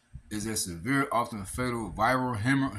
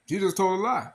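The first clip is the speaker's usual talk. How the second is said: outraged shout; 9 semitones higher, 5 dB louder than usual. Real, not acted. Outraged repeat of accusation.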